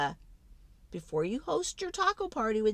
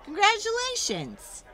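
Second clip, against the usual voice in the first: high, loud congratulations; cheering; 13 semitones higher, 5 dB louder than usual. Acted. Storyteller congratulating the child on the party. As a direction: cheer the kid on.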